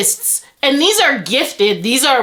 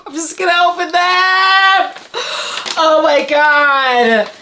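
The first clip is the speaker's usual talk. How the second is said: overwhelmed squeal; 4 semitones higher than usual. Real, not acted. Vlogger opening the books, overwhelmed wail. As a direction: wail it, overcome with excitement.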